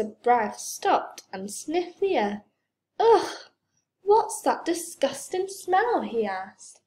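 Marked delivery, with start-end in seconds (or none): 3.0-6.0 high, loud burst of disgust; revolted, high-pitched exclamation. A narrator's reading. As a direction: recoil from a horrible stink. Visitor reacts to a stink.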